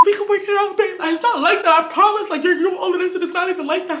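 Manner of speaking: high-pitched mimicked coaxing; mock pleading. Girlfriend begging boyfriend to work it out, high mock pleading. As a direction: plead with him in her high voice.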